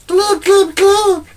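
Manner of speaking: very high squeaky alien glub; squeaky alien noises; alien gurgle. Retold or imitated. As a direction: make a tiny alien burble. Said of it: "And the aliens are like".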